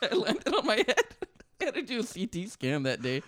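laughing through the words, high; cracking up mid-story; giggling as he says it. Real, not acted. Laughing while retelling the crash.

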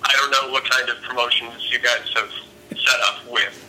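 ordinary delivery

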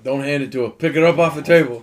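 loud warning. Real, not acted. Warning about knife luck.

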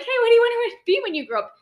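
high, quick, bright guidance-counselor voice; mock cheery question. Asking a high schooler about the future, high and quick. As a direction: bounce it up high like chatting to a teen.

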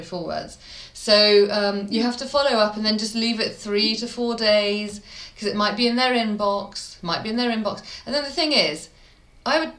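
ordinary talk, nothing marked

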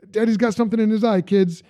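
playful dad cover-up. Retold or imitated.

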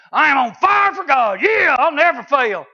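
loud, high, fired-up boast; overconfident shout. Acted. Preacher mimicking a believer on fire for God, overconfident shout. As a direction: shout it out with cocky zeal.

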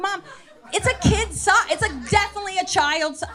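hammed-up disbelief at mom; exasperated.

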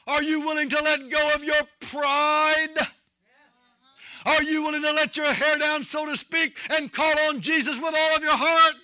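loud, urgent sermon challenge; impassioned preaching. Real, not acted. Preacher challenging his congregation to get serious with God, impassioned. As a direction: press the congregation with insistent preaching.

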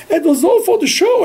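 high-pitched pleading in the people's voice; acted, animated excuse; earnest protest. People voiced explaining themselves to Moses.

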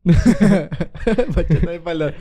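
drawn out slowly through laughter; real laughter breaking up the words; laughing. Real, not acted. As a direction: repeat it while cracking up. Co-host laughs at the phrase.

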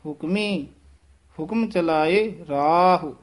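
ਹੁਕਮੀ, clear stop, then slow ਹੁਕਮ ਚਲਾਏ ਰਾਹ; pause after Hukmi. Shown as a demonstration. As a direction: rest on Hukmi before carrying on. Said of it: "ਹੁਕਮੀ ਪਦ ਤੇ ਵਿਸਰਾਮ".

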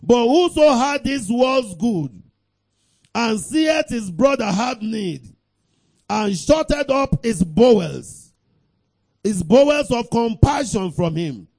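loud, high, slow declamation; preaching scripture. Real, not acted. Preacher reading the verse to the congregation, full-force declamation. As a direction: proclaim each phrase forcefully.

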